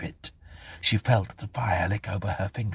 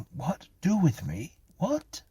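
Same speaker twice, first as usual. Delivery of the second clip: high-pitched, startled echo; bewildered question; 11 semitones higher than usual. A narrator's reading. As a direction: ask back in alarmed confusion. Clara startled by the policeman.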